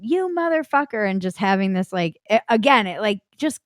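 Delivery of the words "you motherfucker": retold rage. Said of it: "the sobbing turned to rage".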